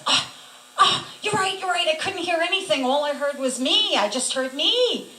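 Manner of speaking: high, loud, eager admission; acted sudden realisation. Woman admits her fault to Jesus, excitedly emphatic.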